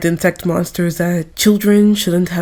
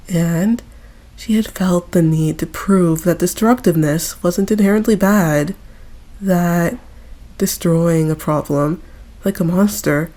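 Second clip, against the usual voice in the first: plain reading voice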